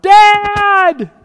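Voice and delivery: very loud, high shout; yelling for Dad. Retold or imitated. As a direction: scream out for Dad like a scared boy. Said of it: "until I yell out".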